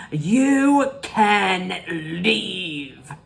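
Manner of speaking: very slow, drawn-out mock scream; dramatic retold outburst, long held words; imitated scream. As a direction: stretch out each word in a mock screech. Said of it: "when she screams, when she looks at her".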